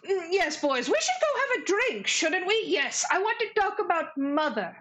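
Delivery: high, lilting pompous monster voice; sing-song it like a tipsy brother; bullywug voice. Acted. Bullywugs leaving to go drink, high and lilting. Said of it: "and they're like".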